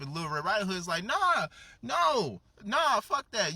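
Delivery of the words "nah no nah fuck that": high, loud mock refusal; raised, emphatic story-voice no; acted defiant refusal. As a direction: shut them down as an indignant character.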